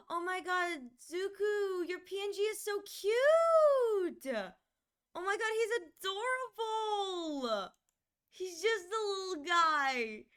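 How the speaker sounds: high, loud, slow squeal of delight; gushing. Real, not acted. Streamer squeals over a viewer's adorable PNG. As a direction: coo over something adorable.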